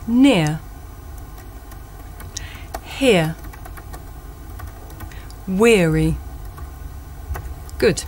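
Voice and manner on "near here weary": Near, Here, Weary with a gliding ear, pausing for repeats; model words again. Shown as a demonstration. Teacher gives words for pupils to repeat, careful and clear. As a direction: say each, then leave room to repeat. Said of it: "repeat after me".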